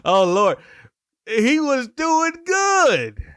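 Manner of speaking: high, loud, slow preacher's cry; drawn-out jubilant outburst; exultant exclamation. Real, not acted. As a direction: cry it out slowly in triumph. Preacher exclaims over his point.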